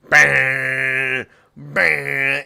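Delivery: long, held guitar-chord noise; imitated power chord. Showing the guitarist repeating one power chord, imitated guitar noise. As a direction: drone out a crunching chord by mouth. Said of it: "a power chord over and over".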